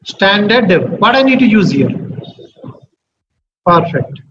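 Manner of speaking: usual speaking voice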